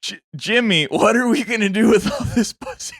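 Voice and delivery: cartoon sidekick voice. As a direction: read it like a cartoon side character. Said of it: "give me this line read".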